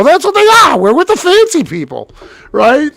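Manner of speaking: high, loud mock indignation; excited insistence. Telling brother they are fancy people, high and loud.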